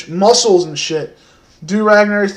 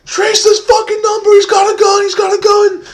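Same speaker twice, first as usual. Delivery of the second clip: much higher, frantic yelling; panicked scream; 5 dB louder than usual. Retold or imitated. Teen screaming at the 911 operator, much higher and frantic. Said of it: "I'm just like".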